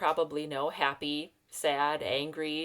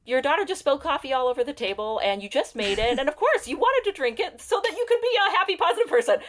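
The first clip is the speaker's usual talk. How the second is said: higher, louder, building into laughter; mock-indignant self-validation; 9 semitones higher, 6 dB louder than usual. Mother justifies her anger to herself over spilled coffee, comically indignant. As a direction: rant in rising comic exasperation, ending in a laugh.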